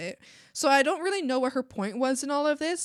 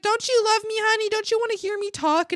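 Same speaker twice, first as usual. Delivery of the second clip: high, louder mock mom; imitating mom; 12 semitones higher, 5 dB louder than usual. Imitating her mom asking if she's loved, high and pleading. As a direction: mimic a pleading mother, pitched up. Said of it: "she's like".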